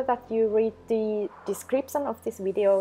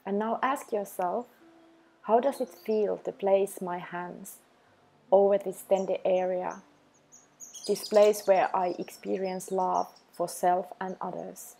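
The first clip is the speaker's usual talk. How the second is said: calm, even meditation voice with long pauses; unhurried, level delivery for a self-love practice; guided meditation. Acted. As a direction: guide the listener gently into the breath, leaving space after each phrase.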